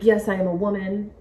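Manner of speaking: ordinary talk, nothing marked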